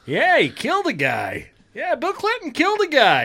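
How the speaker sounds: high-pitched mock insistence; Norm Macdonald. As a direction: blurt it out high, imitating a comedian. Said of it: "He goes".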